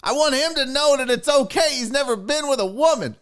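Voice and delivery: put-on high, overfriendly old man voice, way up in pitch; creepy dad voice. Comic retelling the plane dad, high eager voice. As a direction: play the creepy airplane dad, eager and chummy.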